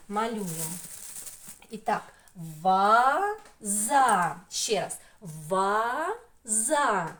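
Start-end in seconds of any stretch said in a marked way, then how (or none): 2.6-4.3 ВАЗА split into two long, loud syllables, VA - ZA. Shown as a demonstration. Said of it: "слово ділиться на два склади". 5.2-7.1 Ваза said as two drawn-out syllables, VA - ZA. Shown as a demonstration. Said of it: "слово ділиться на два склади".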